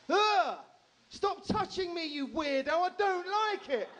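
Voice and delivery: high, drawn-out mock child's yell; hammed-up little girl shouting for all to hear; top-of-voice child shout. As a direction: imitate a seven-year-old shrieking in protest. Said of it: "at the top of her voice, then shouted out".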